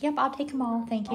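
a cat eagerly claiming every kitten; greedy cat voice. Retold or imitated. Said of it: "And Gigi's like".

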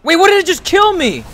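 high, loud disbelief; stunned it just happened; shocked. Real, not acted. Killed by surprise.

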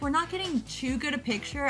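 ordinary talk

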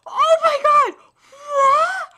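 high, very loud exclamations; shocked shouting. Real, not acted. Reader sees the attack land, stunned shouting. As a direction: scream it out in thrilled disbelief.

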